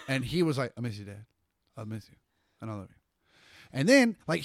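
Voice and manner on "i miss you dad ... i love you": flat, emotionless. Retold or imitated. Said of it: "with so little emotion".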